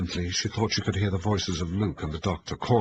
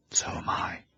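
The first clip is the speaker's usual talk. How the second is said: breathy frightened whisper; 4 dB quieter than usual. A narrator's reading. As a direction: whisper it shivering. Cold, frightened in the dark.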